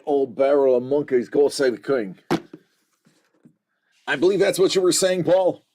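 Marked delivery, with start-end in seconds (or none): 0.0-2.2 put-on cockney accent, not that great; weak cockney. Shown as a demonstration. Host parroting a viewer's cockney rhyming slang, weak cockney accent. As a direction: have a go at amateur cockney patter. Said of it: "my cockney is not that great".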